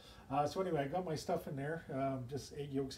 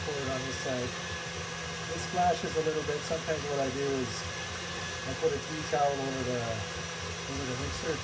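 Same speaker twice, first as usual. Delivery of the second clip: raised voice over a running mixer; louder, carrying demonstration voice; talking louder over machine; 5 dB louder than usual. Real, not acted. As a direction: project louder to be heard over the motor. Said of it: "I'll try to talk a little bit louder".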